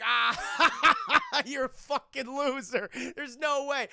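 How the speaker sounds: loud, high jeering laugh through the words; mocking laughter. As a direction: laugh in his face as a retold heckler. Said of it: "they're all mocking him".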